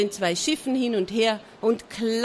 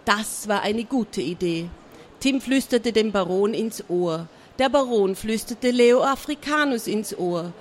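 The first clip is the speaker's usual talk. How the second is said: usual speaking voice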